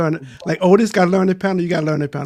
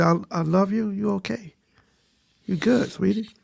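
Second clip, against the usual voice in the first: soft, sugary softer reassurance; mock sweet reassurance; 7 dB quieter than usual. Husband sweet-talking his wife about her weight, softly. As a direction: coo it gently like sweet-talking a partner.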